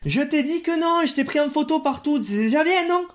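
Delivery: exasperated scolding. Acted. Vlogger scolds the kid for spoiling the secret, exasperated. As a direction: scold the pest who ruined it.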